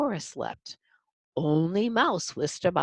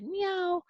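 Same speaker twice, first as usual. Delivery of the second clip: cat meow; 14 semitones higher than usual. Acted. Cat meows.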